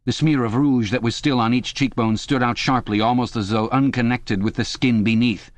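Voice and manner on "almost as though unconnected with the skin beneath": usual speaking voice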